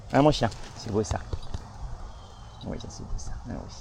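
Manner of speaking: soft unvoiced whisper; whispering to a dog. Real, not acted.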